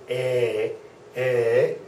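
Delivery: "A" said as an open German e, like "eh"; German Ä sound. Shown as a demonstration. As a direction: demonstrate Ä as a clear eh vowel. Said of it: "sounds just like the German E".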